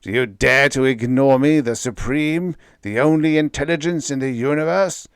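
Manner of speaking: loud, high, imperious voice of a vast mind; a disembodied intelligence scorning its visitors; booming cosmic intelligence. A narrator's reading. Cosmic voice addressing Bill and Molly, loud and imperious.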